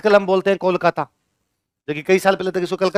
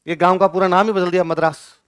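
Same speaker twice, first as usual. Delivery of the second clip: ordinary delivery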